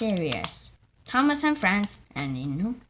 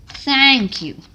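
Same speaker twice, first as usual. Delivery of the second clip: loud thanks; 5 semitones higher, 12 dB louder than usual. Acted. Stewart thanks driver.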